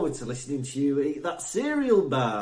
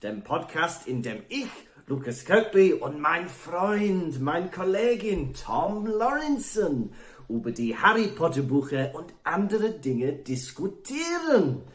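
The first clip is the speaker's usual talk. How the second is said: lightly put-on German host voice; mock German presenter. Welsh co-host pretending to be German, light German accent. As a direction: play at being a German presenter. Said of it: "Yes, that's German, that boy's speaking".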